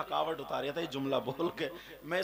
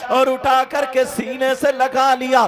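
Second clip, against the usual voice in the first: loud chanting sermon with a half-sung lament; preaching at full force; 9 semitones higher, 18 dB louder than usual. Acted. Preacher chanting Hafsa's plea and the Prophet's reply to the crowd, loud and sung. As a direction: ring it out to the whole gathering.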